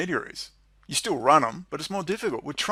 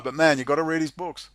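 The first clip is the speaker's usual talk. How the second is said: ordinary talk, nothing marked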